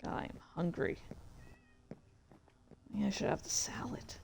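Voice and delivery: soft whispered muse to herself; hushed murmur about food; whispered aside. Real, not acted. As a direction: whisper it like a private thought. Muttering to herself while playing.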